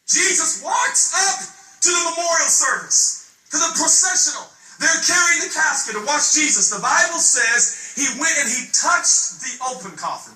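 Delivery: shouted, high preaching climax; roaring the story to the church; full-force preaching. Acted. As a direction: preach it out at the top of your voice.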